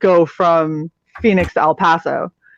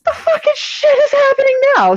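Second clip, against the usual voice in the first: high, stunned squeak of disbelief; retold disbelief. Motel guest hears sirens at midnight, stunned high squeal. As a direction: shriek it like you can't believe it. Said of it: "And I was like".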